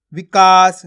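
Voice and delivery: "विकास" joined as one word, loud long "का"; जोड़कर उच्चारण. Shown as a demonstration. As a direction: read "विकास" whole, leaning loudly on the drawn-out middle vowel. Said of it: "आपस में लाके पढ़ देना है". ("विकास" को जोड़कर, "का" ज़ोर से खींचकर)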